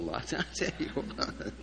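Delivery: laughing softly through the words; chuckling. Real, not acted. Preacher chuckling at his own joke about song leaders. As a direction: chuckle it out, amused.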